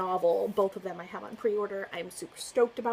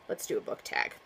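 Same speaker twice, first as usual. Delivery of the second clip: lower, whispered and drained; weary whisper; 11 semitones lower, 5 dB quieter than usual. Real, not acted. Vlogger, worn down by the news, whispering. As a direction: let it slip out as a tired whisper. Weary after sad topic.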